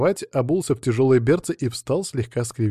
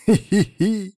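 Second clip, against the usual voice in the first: high, drawn-out "hee-hee-hee"; gleeful giggle; 9 semitones higher, 3 dB louder, slower than usual. A narrator's reading. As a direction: giggle slyly at your own trick. Narrator gloats over his trick.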